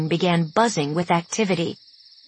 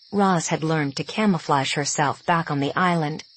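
plain reading voice

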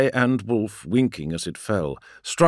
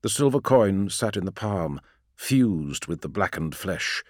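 ordinary delivery

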